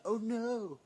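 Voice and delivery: high, loud cry of dismay; hammed-up high-pitched panic; mock dismay. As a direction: wail it in comic alarm at yourself. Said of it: "and then I'm like".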